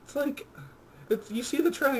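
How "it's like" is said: ordinary delivery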